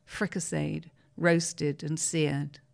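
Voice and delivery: usual speaking voice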